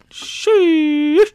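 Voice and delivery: high, loud drawn-out sheesh; amazed exclamation. Real, not acted. Reviewer reacting to a double spread, high drawn-out amazement.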